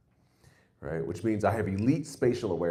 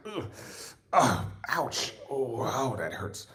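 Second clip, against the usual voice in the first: mock pained groans; 3 semitones higher, 3 dB quieter, much slower than usual. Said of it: "I'm like".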